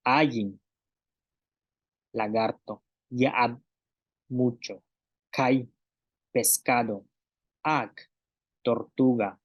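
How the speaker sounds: palabras mayas con glotal, cada una despacio y clara, con su traducción; pronunciación maya. Shown as a demonstration. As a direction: marcar bien la glotal en "Ik" y "Ak", como maestra. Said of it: "vamos a repetir también dos veces estas palabras". (maestra pronunciando maya para la clase)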